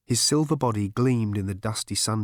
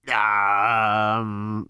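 thinking tank; 5 dB louder than usual. Acted. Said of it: "vibrating with unaccustomed thought".

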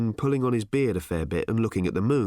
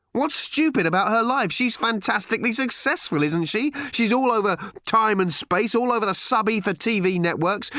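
higher, exasperated raised voice; heated retort; 11 semitones higher than usual. A narrator's reading. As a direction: argue back hotly, pitch up. Father arguing back in the quarrel.

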